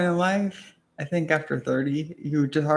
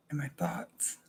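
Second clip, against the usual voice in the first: whispering; 12 dB quieter than usual. Real, not acted. Quiet musing alone at night.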